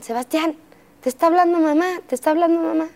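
put-on high-pitched child calling her brother; imitating little sister calling. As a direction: call out high like a little girl insisting.

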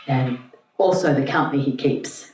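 ordinary delivery

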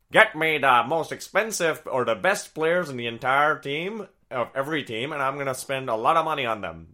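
a hint of a brash old tycoon; lightly voiced greedy banker boasting; 1920s Wall Street bull. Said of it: "That's my, uh, nineteen twenties Wall Street bull impression".

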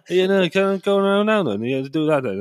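a playful take on a Valleys Welsh father-in-law; Valleys Welsh impression. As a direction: imitate the wife's dad, Welsh and mumbling. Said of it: "like, Valley's Welsh, and he mumbles".

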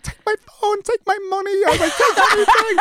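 laughing high-pitched surrender to the mugger; mock panicked pleading. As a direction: beg the mugger in a hammed-up squeak. Retold mugging, panicked begging.

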